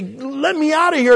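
high, loud plea to be let out; desperate prisoner's cry; pleading cry. Acted. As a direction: beg to get out of the cell. Prisoner begging to be freed.

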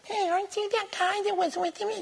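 put-on high, soft girl's voice; a small girl calling out to Peter; servant girl. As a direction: voice the little servant girl lightly.

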